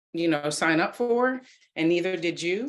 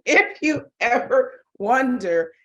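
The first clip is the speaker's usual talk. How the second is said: higher, louder and slow, with laughter; laughing emphasis; 7 semitones higher, 6 dB louder, slower than usual. Real, not acted. Teacher restarting after seeing a colleague's face, laughing emphatically. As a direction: laugh while drawing out each word.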